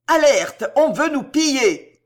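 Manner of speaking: high, loud shout of alarm; alarmed cry. A narrator's reading. Woman warning the troop of looting, alarmed cry. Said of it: "cria une ribaude".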